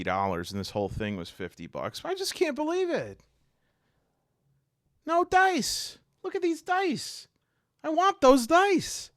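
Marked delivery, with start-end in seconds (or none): 2.0-9.1 high-pitched petulant complaint; indignant whine. Real, not acted. Collector tells viewers he wants the dice, high petulant whine. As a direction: whine up high for the dice you can't have.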